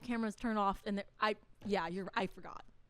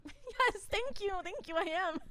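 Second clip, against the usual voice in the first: flattered gush; 10 semitones higher than usual. Retold or imitated. Her flattered past self.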